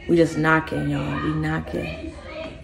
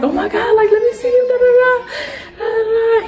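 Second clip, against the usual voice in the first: high, loud pleading cry; mother begging the father to let her see her baby; mock frantic scream; 3 dB louder than usual. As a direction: retell your desperate screaming. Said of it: "So I'm screaming".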